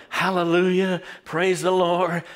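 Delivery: mock crowd praising, kept at talking volume; imitated praise cries. Billions of people crying out to God, imitated praise. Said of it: "people are just crying out to God and praising him".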